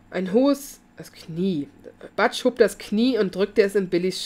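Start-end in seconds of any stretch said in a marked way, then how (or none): none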